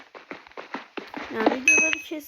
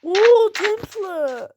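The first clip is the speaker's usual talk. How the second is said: loud, higher, very slow amazement; amazed drawn-out oo; 8 semitones higher, 9 dB louder, much slower than usual. Real, not acted. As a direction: stretch it out in wonder. Spots a temple. (tapınağı görünce hayranlıkla uzatarak)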